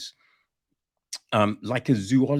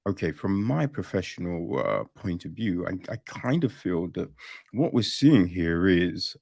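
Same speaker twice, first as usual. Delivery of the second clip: measured professional; 7 semitones lower than usual. Acted. Real scientist giving a professional view, calm and measured. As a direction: offer an opinion politely, low and careful. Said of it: "being disrespectful. They'll just be like".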